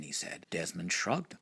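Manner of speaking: usual speaking voice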